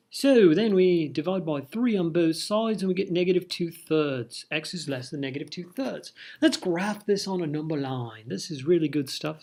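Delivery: hammed-up British accent. Teacher joking through the algebra, mock British accent. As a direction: put on a playful mock British voice. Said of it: "doing my British accent".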